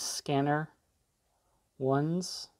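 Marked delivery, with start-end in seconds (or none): none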